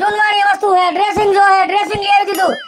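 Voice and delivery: high, fast, furious outburst; angry shouting. Acted. Husband scolding his lazy wife over the dishes, angry shout. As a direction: let it boil over at her.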